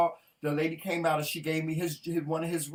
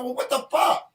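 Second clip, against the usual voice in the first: loud, high outraged outburst; retold yell; 7 semitones higher, 7 dB louder than usual. Wife retelling her reaction to the hospital's explanation, yelling. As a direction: reenact an indignant yell at staff. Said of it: "I'm yelling out in the parking lot".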